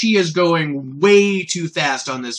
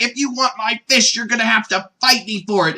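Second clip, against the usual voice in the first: Goku; 6 semitones higher than usual. Acted. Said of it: "he's like".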